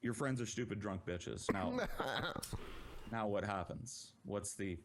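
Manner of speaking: low, deadpan insult; lightly somber taunt to the drunk sister. Retold or imitated. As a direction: say it flatly and gravely, daring her. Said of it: "very somberly and I said".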